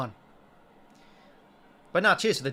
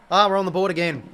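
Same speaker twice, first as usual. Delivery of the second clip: much louder, celebrating the score; excited goal cheer; 3 semitones higher, 10 dB louder than usual. Real, not acted. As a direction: shout it out as the goal lands.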